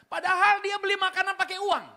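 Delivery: preacher's loud punchline; punchline shout. Real, not acted. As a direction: hit the irony hard and loud. Punchline against pious quote.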